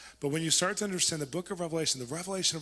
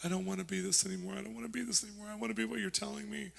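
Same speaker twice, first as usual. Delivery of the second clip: pleading; 7 semitones higher, 4 dB quieter, faster than usual. Retold or imitated. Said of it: "And he's crying".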